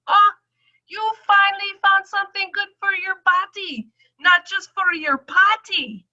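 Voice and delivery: high, loud doctor's delight; high-pitched doctor. Retold or imitated. Doctor delighted by her results, high and loud. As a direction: squeal it like the thrilled doctor. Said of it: "she goes".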